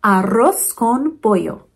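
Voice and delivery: Arroz con pollo said slowly, Spanish rolled r and pollo as poyo; slow Spanish Arroz con pollo to echo; Spanish pronunciation. Shown as a demonstration. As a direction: model Arroz con pollo for the class to repeat. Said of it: "Can you say it with me?".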